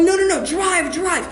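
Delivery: high, panicked getaway order; frantic shout. Acted. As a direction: urge the driver on in mock panic. Acted getaway order to the driver.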